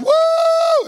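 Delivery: long, loud, very high "woo"; imitated whoop. Preacher acts out Ruby jumping up in church, high loud whoop. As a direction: let out a piercing mock worship whoop. Imitating Ruby's Pentecostal outburst.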